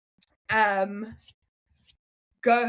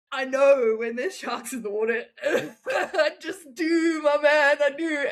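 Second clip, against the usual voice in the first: laughing; 5 semitones higher than usual. Real, not acted. Jokes about knowing shark spots.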